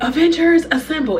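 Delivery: high, loud rally cry; excited. Retold or imitated. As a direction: shout it like a hero. Quoting movie rally cry.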